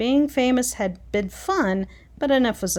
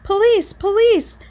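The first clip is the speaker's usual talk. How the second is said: much higher and much louder, full-throated yell for the police; loudest terrifying shout; 11 semitones higher, 7 dB louder than usual. A narrator's reading. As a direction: shout it as loud and scary as you can. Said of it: "he shouted in his loudest, most terrifying voice".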